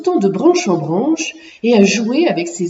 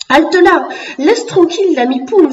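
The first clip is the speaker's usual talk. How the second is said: firm, raised voice, higher and louder; firm exclamation; 6 semitones higher, 4 dB louder than usual. A narrator's reading. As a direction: call a halt as a brave little defender. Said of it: "s'exclama Picou d'une voix ferme". (exclamation d'une voix ferme)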